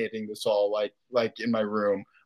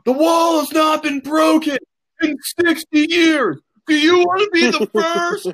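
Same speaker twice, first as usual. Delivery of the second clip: drill sergeant; 14 semitones higher, 6 dB louder than usual. Retold or imitated. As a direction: bark it at the troops like a drill sergeant. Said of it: "walking around like a drill sergeant, ... screaming at them".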